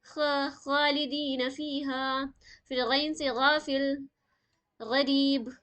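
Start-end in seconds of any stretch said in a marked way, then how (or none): 0.0-2.2 خا drawn out very slowly and heavy in خالدین; slow Quran-teacher demo of خالدین; slow full-mouthed demo. Shown as a demonstration. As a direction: stretch خا from the top of the throat, slowly. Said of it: "منہ بھر کے پڑھیں گے".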